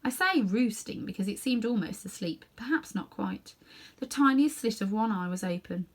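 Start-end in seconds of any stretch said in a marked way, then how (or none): none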